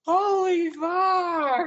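very slow, high awestruck wail; overwhelmed fan. Retold or imitated. Fan watching his guitar get signed, overwhelmed. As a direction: wail it out, stretching every word.

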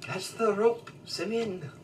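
put-on half-hearted Scottish accent on the yell; attempted Scottish accent. The GM asks for the rope call redone, in a tentative Scottish accent. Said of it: "Can you say that in, like, a Scottish accent?".

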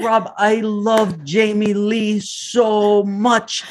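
high, slow gushing; doting mom. Retold or imitated. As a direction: pour out the love slowly. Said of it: "She's like".

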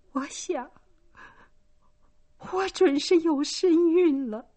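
high, tearful moaning confession. A narrator's reading. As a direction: moan the confession, close to crying. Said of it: "女儿呻吟着说". (高声呻吟着坦白)

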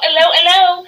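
high, loud imitation English greeting; mock English accent. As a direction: imitate someone answering in fake English. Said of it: "he's acting English all the time".

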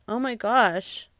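plain reading voice

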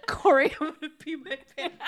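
high, quick words squeezed through laughter; giggling uncontrollably while talking; real helpless laughter. Real, not acted. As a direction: laugh so hard you can barely say it. Helpless laughing fit over a joke.

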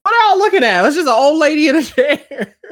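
high, loud incredulous outburst with a laugh; incredulous shout. Retold or imitated. To the hushed gallery crowd, loud incredulous outburst. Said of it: "I almost wanted to go like".

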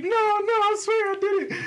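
high mock girl voice denying it; her denial. Imitating his passenger's denial in a high voice. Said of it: "she was like".

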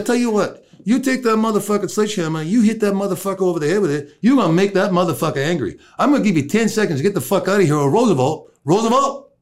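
high-pitched, rapid-fire warning; acted-out heated orders; retold angry threat. Retold threat to the offending man.

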